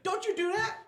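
high-pitched mock yell; imitating loud moviegoer. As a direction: imitate a heckler crying out in the cinema. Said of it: "She was, like, the loud person".

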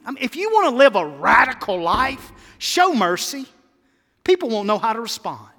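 loud, ringing call to show mercy; fervent preaching. Acted. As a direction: lift it up like a plea. Preaching at full force.

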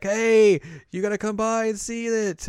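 lightly voiced eager guy; high eager voice. He sees Hiroka, much higher.

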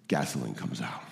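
hushed answer. Acted. Preacher hushes the congregation: soft low answer. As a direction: drop to a near whisper. Answer after rhetorical question.

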